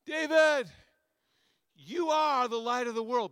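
high, booming call to David; voice of Jesus. Acted. Pastor calls to David as Jesus, loud proclamation. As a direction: shout it out like a proclamation. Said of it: "here's Jesus".

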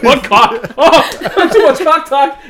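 loud, laughing imitation of shock; laughing mock shock. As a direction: act scandalized through laughter. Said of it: "He's like".

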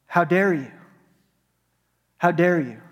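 normal talking voice

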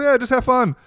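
high, breezy brush-off; breezy dismissal. Retold or imitated. Organizer brushing off the comic's objection, breezy. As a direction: imitate someone waving off a worry.

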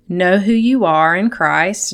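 ordinary talk

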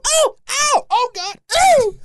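high squealing yelps of pain; hammed-up high yelps; mock screaming. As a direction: squeal like a scared little girl. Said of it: "I'm screaming".

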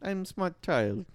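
put-on slow, stretched orphan child voice; orphan impression. Friend reprising the orphan impression, slow child voice. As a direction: draw it out as the orphan. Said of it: "do an impression of the orphan".

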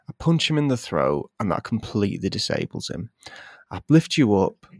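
normal talking voice

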